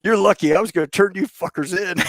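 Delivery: high retold comeback breaking into laughter; laughing retort. Tony telling the pranksters they are lucky, laughing. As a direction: start high, end laughing.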